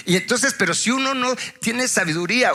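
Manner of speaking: ordinary delivery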